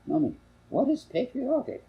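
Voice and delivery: put-on high piping little girl; child's voice. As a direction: ask it like a curious small child. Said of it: "interrupted Linda".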